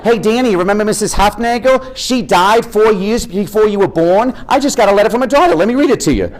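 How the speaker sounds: high, eager mom voice; playful mock mom reading the mail; imitating his mom. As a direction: chirp it like an excited mother with news. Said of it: "my mother has never received a piece of mail".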